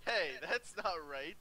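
ordinary talk

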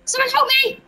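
high, urgent plea; playful cry for help. Pretend. Player begs teammate Ethan for rescue, high urgent call. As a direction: call out for rescue, pitched well up.

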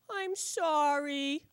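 very slow, soft, high little whimper; a puppy whimpering an apology to its owner. Acted. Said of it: "I whimper".

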